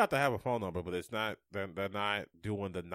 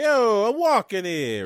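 loud, high New York Italian shout; New York Italian accent; 11 semitones higher, 14 dB louder, slower than usual. Pretend. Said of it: "New York Italian".

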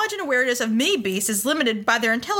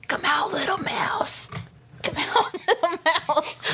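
whisper; 3 semitones higher, slower than usual. Acted. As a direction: coax the mouse in a whisper. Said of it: "So just whisper".

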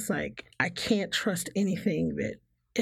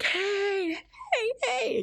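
much higher, cheery repeated hey; perky greeting; much slower than usual. Retold or imitated. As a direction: bounce in with forced cheer. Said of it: "they want to see you be like".

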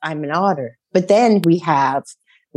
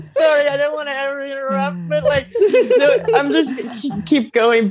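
giggling apology, pitched up; real giggles breaking up the words; laughing; 9 semitones higher than usual. Real, not acted. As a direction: laugh your way through the apology. Laughing through apology after interruption.

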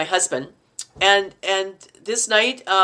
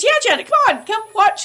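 high, louder imitated coaxing; urging invitation; 12 semitones higher, 4 dB louder than usual. Director calls her over to watch, high excited urging. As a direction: urge them along, pitched high. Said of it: "He says".

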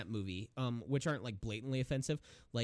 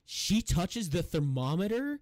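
shocked disbelief; 7 semitones higher, 6 dB louder, slower than usual. Retold or imitated. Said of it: "he's like".

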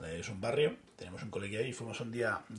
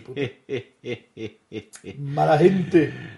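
indignación burlona; 7 dB louder, much slower than usual. Pretend. Indignación fingida por el troleo.

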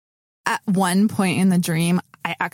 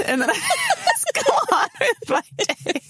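laughing. Real, not acted. Laughs while speaking.

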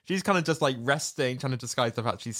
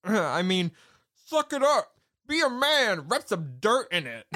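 mock tough guy; 9 semitones higher than usual. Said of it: "then she checks herself".

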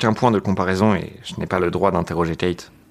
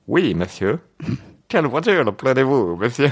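hammed-up bad Italian accent, pitched up; failed Italian accent; 5 semitones higher than usual. Driver asks his boss which car, botched Italian accent. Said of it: "Je sais pas faire l'accent italien".

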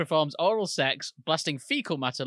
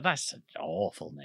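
flat put-down; 5 dB quieter, slower than usual. Retold or imitated. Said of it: "I was like".

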